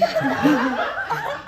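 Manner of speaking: disbelieving laugh, slow. Acted. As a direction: laugh through the words.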